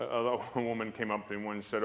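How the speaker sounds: normal talking voice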